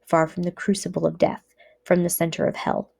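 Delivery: ordinary delivery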